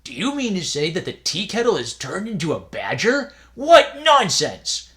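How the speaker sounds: pooh-pooh it, then drag out nonsense; scoffing disbelief. A narrator's reading. Second novice scoffs.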